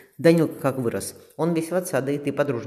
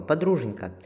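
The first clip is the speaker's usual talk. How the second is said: ordinary delivery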